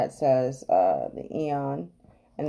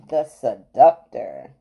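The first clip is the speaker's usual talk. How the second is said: slow, lower, weighty announcement; dramatic card reveal; 4 semitones lower, 7 dB louder, slower than usual. Acted. Reader unveils the Seductor card, dramatic reveal. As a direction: announce it slowly, savouring each syllable.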